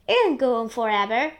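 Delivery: loud, quick outburst; yelled. A narrator's reading. An aunt on the ever-growing peach, yelled. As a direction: call it out fast and loud. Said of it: "and Spiker yelled".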